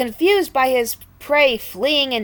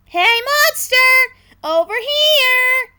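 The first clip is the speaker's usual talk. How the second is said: high, slow taunting yell, much louder; 6 dB louder, slower than usual. A narrator's reading. Birds yelling to lure the monster away. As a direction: bait the monster with a loud call. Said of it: "the Blues yelled".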